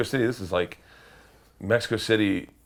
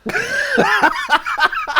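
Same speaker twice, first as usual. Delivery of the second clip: high, loud cackling burst; big laugh; 4 dB louder than usual. Real, not acted. Comic laughing at his own cowardice, big laugh. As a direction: crack up after the punchline.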